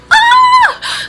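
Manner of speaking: short high shriek of annoyance; frustrated shriek. Real, not acted. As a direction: let out a sharp high cry at the villain. Fan shriek at annoying guy.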